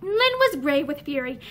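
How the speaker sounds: plain reading voice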